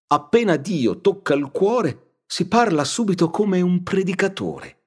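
high, marvelling mother's voice; admiring Agnese. Acted. Mother marvelling to Lucia, in a high awed voice. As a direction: pitch it high in simple wonder. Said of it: "disse Agnese".

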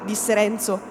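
ordinary delivery

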